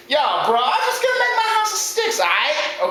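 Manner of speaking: high-pitched cocky bro voice; squeaky-high slangy little pig; bro-talking second pig. Acted. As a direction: pitch it way up, like a carefree dude.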